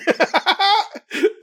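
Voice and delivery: a sudden high burst of laughter. Real, not acted. Cohost laughing at his friend's phone story, a high burst of laughter.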